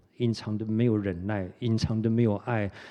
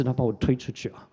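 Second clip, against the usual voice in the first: normal talking voice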